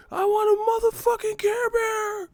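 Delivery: put-on very high, shrill tantrum scream of a child; screaming kid. Kid at Toys R Us screaming for a Care Bear, high tantrum. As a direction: wail like a spoiled kid in a toy store.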